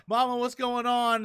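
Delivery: high, loud panicked cry; Napoleon freaking out. Retold or imitated. Napoleon calling to Mama in alarm, mock freak-out. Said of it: "Napoleon's freaking out".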